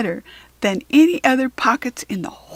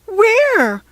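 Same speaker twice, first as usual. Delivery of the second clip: high, loud, eager cry; cried out; 11 semitones higher, 6 dB louder than usual. A narrator's reading. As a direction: she cries out in hope. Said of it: "cried Katy".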